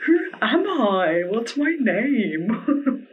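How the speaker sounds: high, slow, gushing amazement; stretched-out giddy wonder; giddy disbelief. Real, not acted. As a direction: swoon over your own reflection. Gushing over her new wig.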